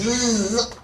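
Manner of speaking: insistent imitated buzz; drawn-out vocal zzz, growing; buzzing bee. Bee breaks into man's prayer, imitated buzzing. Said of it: "this bee's getting louder and louder".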